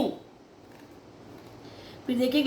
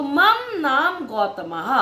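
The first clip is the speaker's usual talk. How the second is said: slow, clear Sanskrit for pupils to repeat; teacher modelling words for children to echo; slow model pronunciation; much slower than usual. Shown as a demonstration. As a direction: pronounce "मम नाम गौतमः" slowly for children to copy. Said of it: "आप भी उसको same pronounce करते जाइए".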